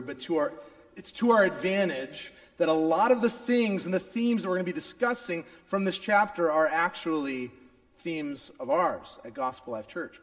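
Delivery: normal talking voice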